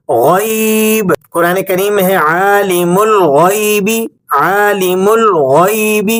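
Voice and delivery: الغیب drawn out with a heavy throaty غ; heavy ghain demo. Shown as a demonstration. Teacher reciting عالم الغیب for students, heavy and slow. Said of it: "ایک اور مثال ہے غیب".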